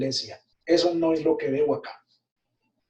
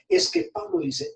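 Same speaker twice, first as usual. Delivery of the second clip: normal talking voice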